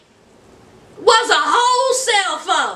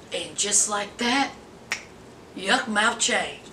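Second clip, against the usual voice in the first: much lower, softer and slow, like a trailer voice; ominous narrator; 11 semitones lower, 9 dB quieter, slower than usual. A narrator's reading. Recap narrator announcing his sudden turn, low and ominous. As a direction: drop into a dramatic reveal.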